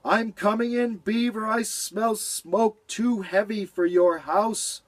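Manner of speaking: high, urgent cat voice. Acted. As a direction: rush in to help. Cat voiced, smelling smoke.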